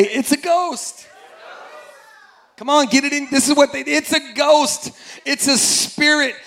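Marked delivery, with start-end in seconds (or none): 0.0-1.1 call-and-response shout. Shown as a demonstration. Preacher leads the congregation to repeat, slow shout. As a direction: lead the congregation, drawn out. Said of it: "Everybody say".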